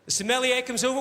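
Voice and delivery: normal talking voice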